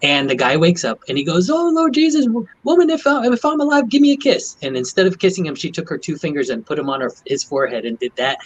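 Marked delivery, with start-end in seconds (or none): 1.5-4.6 high imitated patient begging; pleading patient. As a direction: beg high like a dazed patient. Patient begging nurse kiss.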